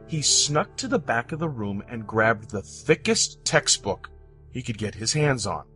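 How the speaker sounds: usual speaking voice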